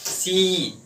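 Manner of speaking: drawn-out "सी", the ee held long; खींचा हुआ अंतिम स्वर. Shown as a demonstration. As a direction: say "सी" slowly, letting the final ee ring on. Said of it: "ई आ रहा". ("सी" की ई को लंबा खींचकर)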